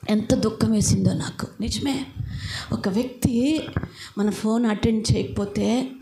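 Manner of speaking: normal talking voice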